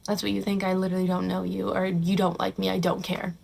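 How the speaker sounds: soft, low, unbothered shrug; calm indifference. Pretend. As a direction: say it quietly, not rising to it. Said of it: "I'd literally be like".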